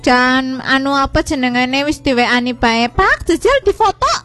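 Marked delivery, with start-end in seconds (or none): 2.9-4.1 high, fast mock nagging mum; imitating mum. Mum messaging dad about lunch, high mock nagging. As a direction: pipe up like a bossy mum checking the food.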